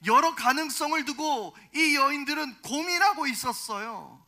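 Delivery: forceful sermon delivery; emphatic preaching. Real, not acted. Preacher raises voice to expound.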